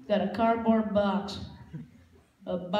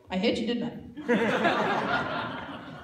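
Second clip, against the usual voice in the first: normal talking voice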